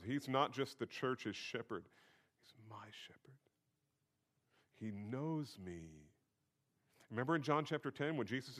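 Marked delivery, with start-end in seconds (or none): 2.4-3.4 hushed, intimate whisper; soft whisper of personal belonging. Retold or imitated. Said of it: "very personally". 4.8-6.1 soft, very drawn-out words; hushed, lingering personal confession; intimate. Real, not acted. Intimate personal claim in sermon.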